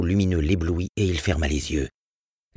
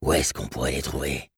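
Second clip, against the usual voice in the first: husky, gentle stranger; 4 semitones lower, 4 dB quieter than usual. Acted. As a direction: lower man's voice, lightly husky. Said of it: "une voix rauque mais douce".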